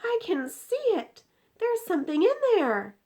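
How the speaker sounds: high, loud gasp of discovery; thrilled to see it; girl crying out at the jar; excited cry. Acted. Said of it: "cried out".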